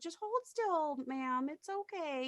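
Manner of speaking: soft, high, slow reassurance; placating, lighter voice, drawn out; soothing nurse. Retold or imitated. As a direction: imitate a nurse gently humoring a patient. Nurse soothing confused patient.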